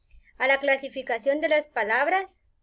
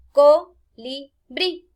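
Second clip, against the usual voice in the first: "Co-li-brí" in slow, separated, louder beats; syllable by syllable; 2 semitones higher, 7 dB louder, much slower than usual. Shown as a demonstration. Teacher counting the word's syllables aloud, syllable by syllable. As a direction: split "Colibrí" into three with pauses. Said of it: "tiene tres sílabas".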